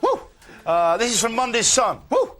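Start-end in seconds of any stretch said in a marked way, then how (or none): 0.0-0.2 high gleeful woos; whooping. Real, not acted. Host cheering the pun, high whoop.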